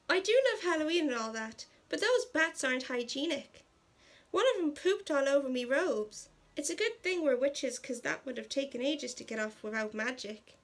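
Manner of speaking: put-on amateur Irish accent, mildly irritated; terrible Irish accent. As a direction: put on a hammed-up Irish lilt. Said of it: "Sorry for my terrible Irish accent".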